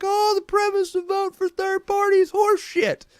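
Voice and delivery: high mocking put-on voice brushing off third parties. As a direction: squeak it out like a know-it-all you're ridiculing. Mocking a dismissive voter.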